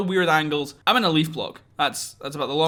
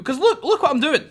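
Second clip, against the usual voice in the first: laughing through the words, high and louder; 9 semitones higher, 4 dB louder than usual. Real, not acted. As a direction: crack up at your own mess. Laughing at his own bad build.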